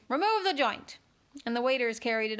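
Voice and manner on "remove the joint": high, loud royal order; imperious command. Acted. Queen dismisses the joint, high and imperious.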